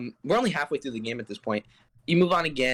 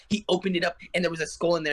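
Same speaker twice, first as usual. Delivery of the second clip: ordinary delivery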